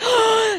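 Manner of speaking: quick high gasp of surprise; sharp retold gasp. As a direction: catch your breath in sudden surprise. Reacting as pushing starts.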